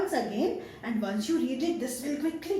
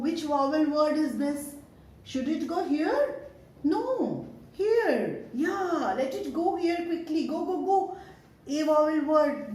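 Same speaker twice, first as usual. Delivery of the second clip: ordinary talk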